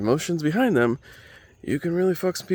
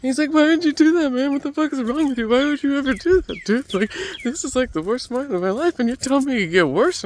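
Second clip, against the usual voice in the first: imitating a crying man; 13 semitones higher, faster than usual. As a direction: play up a sobbing stranger's outrage. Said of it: "starts crying in front of me".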